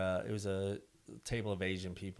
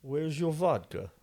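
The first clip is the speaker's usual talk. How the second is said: slow, higher put-on Russian accent; Russian accent attempt; 5 semitones higher, slower than usual. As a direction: try a drawn-out mock Russian accent. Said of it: "Now, what's your Russian accent?".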